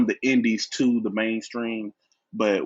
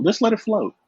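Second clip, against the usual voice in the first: higher, sing-song "Just let it flow"; lilting repeat; 11 semitones higher, 5 dB louder than usual. Shown as a demonstration. Guest repeating the tip to the host, higher and lilting. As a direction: float it up high and easy. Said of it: "ain't gotta put too much emphasis".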